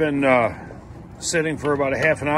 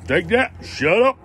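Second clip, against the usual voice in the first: gloating; 5 semitones higher than usual. Real, not acted.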